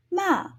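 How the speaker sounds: "ma" falling sharply from high; Mandarin fourth tone. Shown as a demonstration. Teacher demonstrating fourth tone "ma", sharp fall. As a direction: say "ma" with a sharp drop. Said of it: "they should sound something kind of like".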